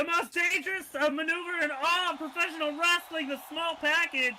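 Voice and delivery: hyped announcer calling the move; excited move call. Real, not acted. Commentator hyping the crowd about the finisher, excited.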